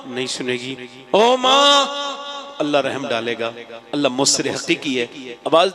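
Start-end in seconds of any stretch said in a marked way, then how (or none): 1.1-2.6 long call. Acted. Son's drawn-out final call.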